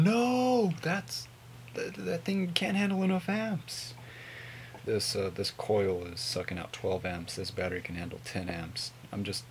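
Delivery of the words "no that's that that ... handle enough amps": high, loud doubting voice; mock skeptic, pitched way up; skeptical viewer voice. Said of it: "I know you guys are thinking".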